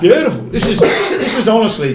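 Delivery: laughing. Real, not acted. Laughing at being fooled.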